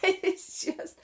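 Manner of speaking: high, squeaky voice breaking up with laughter; helpless laughter. Real, not acted. Co-host cracking up over the gross rock story, helpless laughter. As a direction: try to speak through real helpless laughing.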